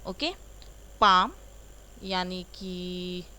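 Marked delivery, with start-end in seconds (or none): 1.0-1.3 पाम, P silent, said loud; silent P demo. Shown as a demonstration. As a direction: say पाम without the P sound. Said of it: "ये पी एस ए एल एम". (पाम बिना P के, ज़ोर से)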